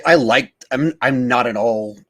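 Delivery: usual speaking voice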